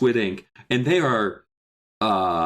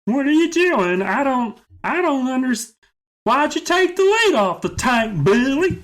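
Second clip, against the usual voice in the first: very high, loud put-on cartoonish voice; Alex; 13 semitones higher, 4 dB louder than usual. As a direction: play him in a piercingly high character voice. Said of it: "What's the voice we gave him?".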